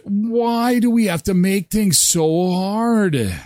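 exasperated. Real, not acted. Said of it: "exasperated over hard words".

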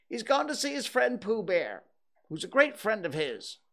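high disguised rabbit voice; Rabbit, evasive. Acted. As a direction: fob off the visitor in a high put-on voice. Rabbit pretends to be another.

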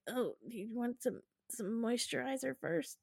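soft, tentative stalling; hesitant mock offer. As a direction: ask gingerly, putting off a kiss. Reluctant man stalling, acted.

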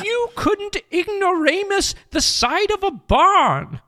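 high-pitched put-on British author; J K Rowling. Rowling answers her exasperated agent, high British voice. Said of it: "I will be J K Rowling".

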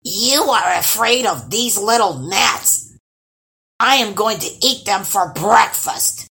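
outraged monster scolding loudly; fuming troll grandma. A narrator's reading. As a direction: fume at them as an angry old troll. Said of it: "she fumed".

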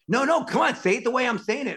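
high, impatient imitation of a director; insistent director. Director corrects actor's line reading, imitated insistence.

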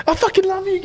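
high, gushing declaration of love; drunken gush. Retold or imitated. Stranger hugging the boys, gushing love in a high voice. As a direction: squeal it like an overly affectionate drunk. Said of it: "and he's like".

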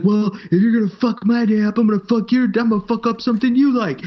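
high, loud spiteful threat; mock angry threat. Sailor threatens the leader after being told no, mock furious. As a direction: spit the threat back at him. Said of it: "the guy got really mad".

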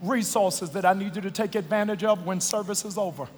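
low soft appeal; quiet. Acted. Softer closing appeal.

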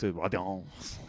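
stretched-out imitation French accent; mock French accent. Game master mimicking the player's weapon name, mock French accent. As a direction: tease with a drawn-out French accent. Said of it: "like, too Pepe Le Pew".

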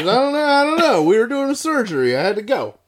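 squeaky high flustered voice; high-pitched, flustered excuse; high mock voice. Acted excuse in surgery.

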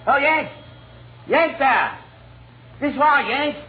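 high-pitched hail across a distance; calling out. Acted. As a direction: call him over from the train. Hailing American soldier outside train.

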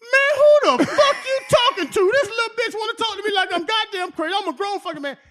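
high, loud furious rant; a veteran insulted and cussing back; angry cussing. Retold or imitated. The lineman cusses out Nick Saban, a loud high furious rant. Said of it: "loud as hell".

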